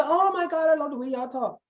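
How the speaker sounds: high, gushing tourist voice. Retold or imitated. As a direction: mimic an excited tourist gushing. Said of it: "they say".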